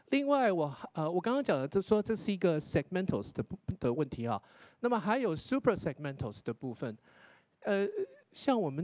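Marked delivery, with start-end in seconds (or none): none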